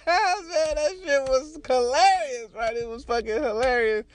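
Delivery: high-pitched, through real laughter; laughing. Real, not acted. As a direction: crack up while telling it. Cracks up at own story.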